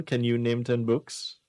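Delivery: normal talking voice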